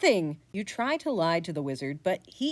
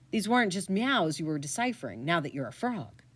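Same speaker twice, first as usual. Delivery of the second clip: ordinary delivery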